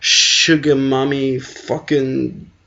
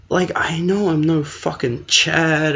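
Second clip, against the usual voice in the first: ordinary talk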